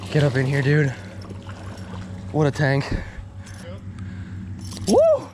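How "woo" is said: loud high whoop; excited whoop. Real, not acted. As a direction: cheer the catch out loud. Big fish just landed.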